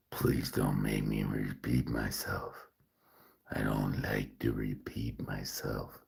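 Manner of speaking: hushed narrator threat. Pretend. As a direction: murmur it like a creepy narrator. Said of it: "talking like I'm a narrator".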